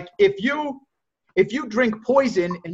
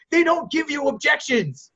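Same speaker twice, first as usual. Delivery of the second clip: usual speaking voice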